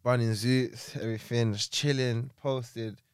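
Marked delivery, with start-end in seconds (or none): none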